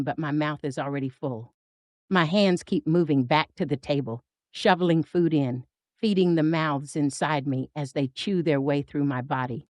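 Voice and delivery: plain reading voice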